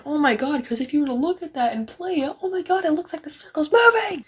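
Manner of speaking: high, rising mock wonder; mocking a viewer amazed by moving circles; mock amazement. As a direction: gasp it like a wowed viewer.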